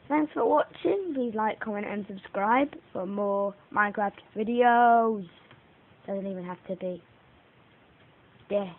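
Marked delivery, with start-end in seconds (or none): 0.1-5.3 upbeat youtuber sign-off; outro sign-off. Real, not acted. Youtuber signing off to viewers, upbeat. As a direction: sell the like and subscribe.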